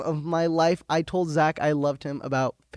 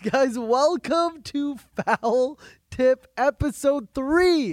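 host intro; 7 semitones higher, much slower than usual. Acted.

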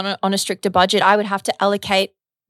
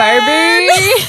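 excited squeal; 9 semitones higher, 7 dB louder than usual. Real, not acted. Announcing the London trip, squealing with excitement. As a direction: squeal it with delight.

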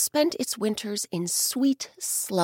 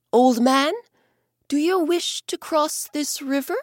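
higher and louder kindly bear calling out; friendly bear; 8 semitones higher, 5 dB louder than usual. Acted. Bear offering the old man a crossing, higher and louder. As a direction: call across the river to the old man.

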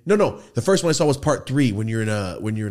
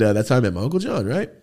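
ordinary talk, nothing marked

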